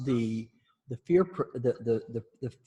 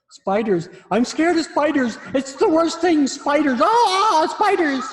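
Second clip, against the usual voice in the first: much higher, louder frantic voice; mock spider panic; 11 dB louder than usual. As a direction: ham up a phobic patient. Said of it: "people that come in saying".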